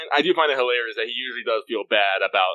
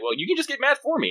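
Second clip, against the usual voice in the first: very high put-on voice; light, high-pitched imitation of an easygoing master; Pepe. Master offering his servant a job, in a high voice. Acting out Pepe's cheerful suggestion.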